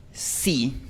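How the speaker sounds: sí with a short, pure Spanish i. Shown as a demonstration. Teacher modelling the Spanish i vowel, clipped and pure. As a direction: say sí crisply, no glide. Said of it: "como en la palabra".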